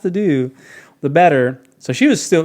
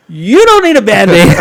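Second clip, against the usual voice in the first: louder, higher, laughing through it; laughing disbelief; 6 semitones higher, 11 dB louder than usual. Retold or imitated. Ranger sees the bloody leg, laughing and loud. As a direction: laugh at how badly he underestimated it.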